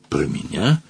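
small choked question, soft and rising; strangled squeak. A narrator's reading. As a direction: squeeze it out, taken aback. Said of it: "сдавленно пискнул Мадж".

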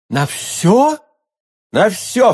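high, eager exclamation; ardent exclamation. A narrator's reading. Milady tests his readiness, D'Artagnan cries out ardently. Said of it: "вскричал Д'Артаньян".

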